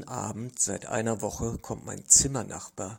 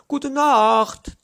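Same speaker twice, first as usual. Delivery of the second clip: a high, loud good night; called through the wall; 13 semitones higher, 14 dB louder than usual. A narrator's reading. Neighbor calling good night loudly through the wall. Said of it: "ruft er mir dann durch die Wand".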